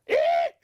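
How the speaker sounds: very high "eek"; startled elephant's squeal; frightened shriek. A narrator's reading. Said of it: "shouted the elephant".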